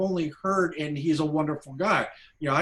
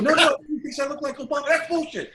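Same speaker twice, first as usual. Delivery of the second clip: fast, much higher, louder banter; playful comeback; 11 semitones higher, 4 dB louder, much faster than usual. Retold or imitated. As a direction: fire it back, laughing along. Playful shouting match with Quincy.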